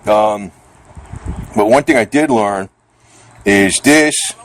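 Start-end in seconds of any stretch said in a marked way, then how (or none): none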